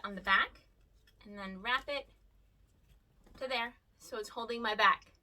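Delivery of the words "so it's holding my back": ordinary delivery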